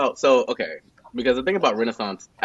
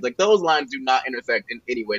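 ordinary talk

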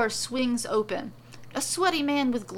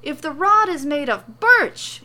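high, loud, agitated protest; frantic yelling; 7 semitones higher, 9 dB louder than usual. A narrator's reading. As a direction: protest in a theatrical panic. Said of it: "Morrow yells".

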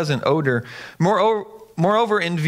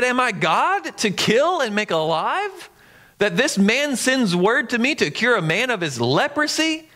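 indignant king; 6 semitones higher than usual. Acted. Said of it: "he tore his clothes and said".

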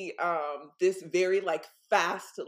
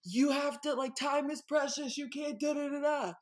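scolding Lords of Time; 8 semitones higher than usual. Retold or imitated. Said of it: "they was all like".

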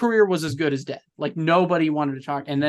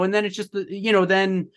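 normal talking voice

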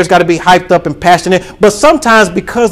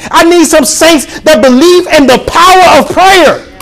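preaching at full force; 7 semitones higher than usual. Acted. Pastor rallying the church to bold prayer, full-force preaching. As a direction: lift the congregation with bold fervor.

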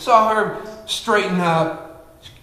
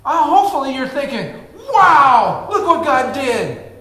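excited preaching; 11 semitones higher, 3 dB louder than usual. Acted. Pastor marveling to his church, high and excited. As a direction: marvel aloud at the miracle for the crowd.

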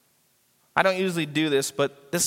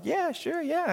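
casual agreement; 11 semitones higher, 5 dB quieter, slower than usual. Retold or imitated. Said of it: "I was just like".

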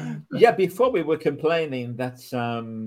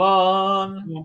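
drawn-out sign-off; 4 semitones higher than usual. Acted. Show sign-off catchphrase.

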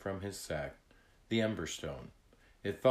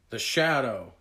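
loud warning call; alarmed yell; 2 semitones higher, 7 dB louder than usual. A narrator's reading. Tag spots the shadow over the bay, alarmed yell. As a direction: call out the danger, loudly. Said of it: "Tag yelled".

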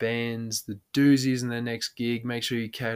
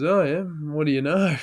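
higher, louder drawn catchphrase; mock-casual catchphrase, pitch lifted; imitated drunk mate; 5 semitones higher, 5 dB louder than usual. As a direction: lightly play the mate with a drunk idea. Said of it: "every time he had a drunk idea".

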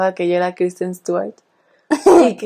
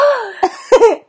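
sharp gasp, pitched way up; loud high gasp; 12 semitones higher than usual. Retold or imitated. Fan watching Twilight gasps at the gift, high shocked gasp. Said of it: "Y yo".

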